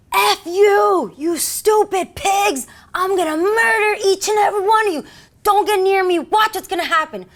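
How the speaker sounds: high, loud enraged yelling, imitated; screaming suspect. Cornered suspect threatening two police officers, screaming. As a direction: scream threats like a cornered man, drawing the words out. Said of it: "And he's screaming".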